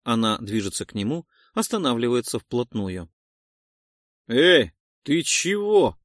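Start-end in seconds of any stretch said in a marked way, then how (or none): 4.3-6.0 put-on old man mumbling. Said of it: "мямлит старый дяденька".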